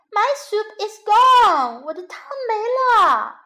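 high, loud wail over the lost soup; baby bear crying out. Acted. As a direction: cry out like an upset little cub. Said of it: "熊宝宝也叫了起来".

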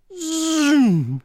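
swooping zoom sound; high whooshing zoom. Acted. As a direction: zoom off into the sky. Dragonflies race away.

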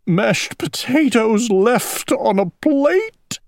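slow, high tearful wail; hammed-up weepy owl; crying. A narrator's reading. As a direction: wail it mournfully, drawing out the words. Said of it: "he cried".

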